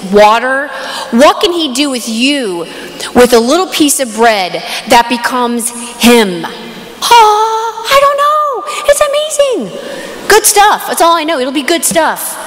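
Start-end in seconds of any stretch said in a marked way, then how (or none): 6.4-10.9 high, drawn-out wonder; amazed gushing. Real, not acted. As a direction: squeal with amazement, stretching the words. Amazed, gushing aside to audience.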